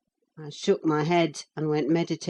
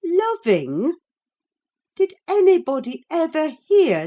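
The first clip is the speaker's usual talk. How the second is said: higher, louder mocking scorn; scornful nurse; 4 semitones higher, 4 dB louder than usual. A narrator's reading. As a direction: scoff at the very idea. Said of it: "as scornfully as I could utter".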